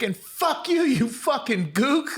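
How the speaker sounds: high, drawn-out mock outburst; woman hurling insults. Driver insults the storyteller, high mock-angry imitation. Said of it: "She was like".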